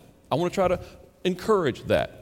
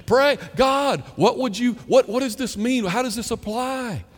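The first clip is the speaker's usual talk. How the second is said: acted pleading; 8 semitones higher, 4 dB louder than usual. Acts out a puzzled plea to God.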